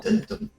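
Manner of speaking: high mock ominous sting; dramatic dun dun. Storyteller adding suspense, mock dun dun.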